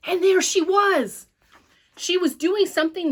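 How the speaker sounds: sudden high cry of discovery; excited discovery. A narrator's reading. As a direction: burst out with relief and alarm. Narrator spots sister in air.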